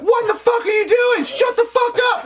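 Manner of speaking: very high, slowed panicked inner voice; mock inner alarm, very high and drawn out. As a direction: scream at yourself inside your head. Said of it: "in my head, it's like alarm bells".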